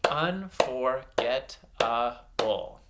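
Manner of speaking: UNFORGETTABLE slowly, a gap after every syllable. Shown as a demonstration. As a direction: clap out "Unforgettable" piece by piece. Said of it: "clap out some syllables".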